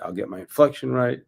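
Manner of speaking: ordinary talk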